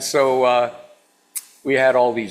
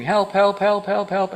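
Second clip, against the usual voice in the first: higher mock scream, quick repeated cries; mild retold cry for help; 7 semitones higher, slower than usual. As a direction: imitate a trapped child calling out. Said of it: "I just start screaming".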